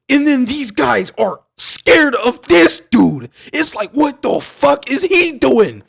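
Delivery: high, loud and drawn out; incredulous outburst. Real, not acted. Fan retelling how scared the killers are, loudly incredulous. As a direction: cry it out in baffled amazement. Amazed at fearsome villain.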